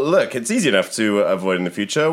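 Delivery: ordinary talk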